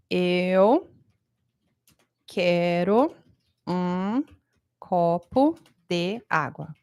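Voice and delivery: slow, words split by long pauses. Shown as a demonstration. Teacher showing students how not to speak. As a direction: say Eu quero um copo de água with a pause after every word. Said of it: "A frase é assim".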